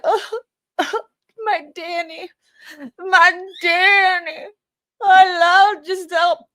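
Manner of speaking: high, wailing mock sob, hammed up; mocking fake crying. Mocking the defendant's fake crying on the stand. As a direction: ham up a fake weepy cry. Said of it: "Do you see her crying like".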